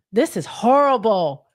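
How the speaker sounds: ordinary delivery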